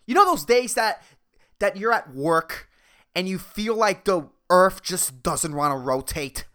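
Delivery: ordinary talk, nothing marked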